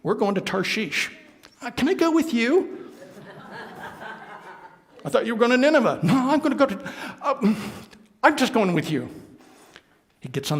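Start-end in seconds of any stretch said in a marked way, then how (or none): 0.0-9.1 sheepish Jonah. Acted. Sailors and Jonah bargaining.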